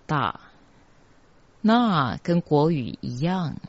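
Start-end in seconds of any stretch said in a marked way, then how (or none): 0.1-0.4 "大" said as an open da; pronounced da. Shown as a demonstration. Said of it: "发音是".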